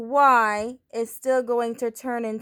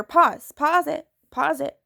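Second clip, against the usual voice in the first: quick, insistent pause it; urgent repeated pause; 5 semitones higher than usual. Real, not acted. Teacher urging students to pause the video. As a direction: rush them to hit pause. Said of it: "Feel free to pause".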